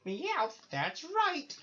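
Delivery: very high, loud cartoon cat voice; terrible high-pitched cat impression; Meowth. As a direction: squawk it out as a cartoon sidekick. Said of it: "sorry about the terrible impressions".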